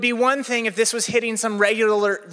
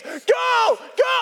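storming shout. Retold or imitated. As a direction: yell them through the storm like a drill coach. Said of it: "She gets in my face".

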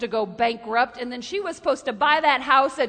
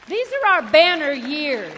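repeated, louder and fuller; loud declaration; 8 dB louder than usual. Shown as a demonstration. Preacher proclaiming to the church, louder on the third time. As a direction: proclaim it to the crowd.

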